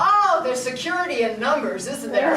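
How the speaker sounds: usual speaking voice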